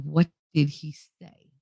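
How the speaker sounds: slow, measured question; imitated stern stepfather. Stepfather asks child again at dinner, imitated slow sternness. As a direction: space out each word deliberately.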